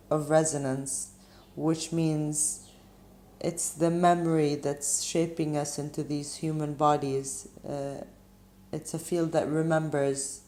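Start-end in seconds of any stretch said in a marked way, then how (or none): none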